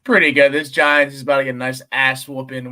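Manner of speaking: booming, pumped-up answer; loud hype. Real, not acted. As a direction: shout it like a fan ready for the game. Loud hype about the game.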